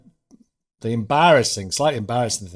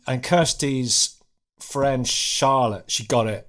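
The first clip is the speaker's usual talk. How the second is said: normal talking voice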